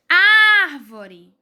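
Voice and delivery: Árvore with a loud, long first syllable Ár; Árvore said loudly, weight on Ár; stress on AR. Shown as a demonstration. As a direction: punch and hold the Ár of Árvore. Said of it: "É o ar".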